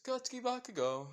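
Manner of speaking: high sing-song name call; devilish grin. Acted. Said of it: "with a devilish grin".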